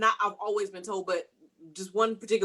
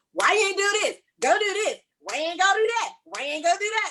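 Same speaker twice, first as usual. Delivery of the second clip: higher, pushy accusing questions; accusing nagging boss; 9 semitones higher, faster than usual. Retold or imitated. Supervisor showing how staff hear her orders, higher and accusing. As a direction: nag them like a fed-up supervisor. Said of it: "but it's received as".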